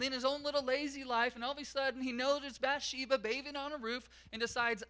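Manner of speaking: rising revival sermon voice; fired-up preaching. Acted. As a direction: preach it out, raising the energy. Preacher builds to full force.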